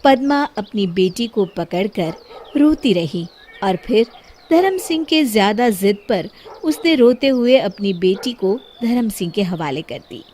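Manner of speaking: ordinary delivery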